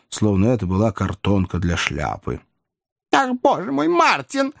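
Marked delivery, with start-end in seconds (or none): 3.1-4.5 put-on very high old lady's exclamation; exclaiming old lady. A narrator's reading. Old lady exclaiming to coachman at the door, very high. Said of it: "воскликнула старая леди".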